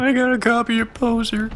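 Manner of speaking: high nerdy voice; mock intern voice. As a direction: play a proud clueless amateur. Mocking a clueless intern.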